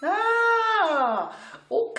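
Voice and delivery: long, high, loud "aah" of understanding; mock lightbulb moment, drawn out; dawning realization. Teacher voicing pupils who finally get the rule, dawning realization. Acts out a student suddenly understanding.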